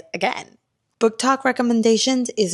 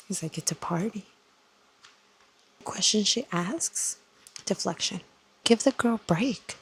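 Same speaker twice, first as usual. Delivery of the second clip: hushed reactions. Real, not acted. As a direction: keep it hushed so nobody wakes. Late-night hushed reading reactions.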